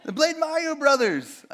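high, loud self-mocking replay of the silly answer; mocking himself. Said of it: "I don't know why I said that".

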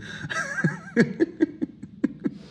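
high, helpless laughter; real laugh. Real, not acted. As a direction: crack up at your own bad impression. Laughing at own bad impression.

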